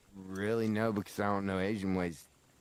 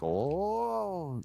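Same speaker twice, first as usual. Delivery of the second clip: high, drawn-out "Oh"; a dramatic K-drama double-take "Oh"; 9 semitones higher than usual. Retold or imitated. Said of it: "that double take they like to do".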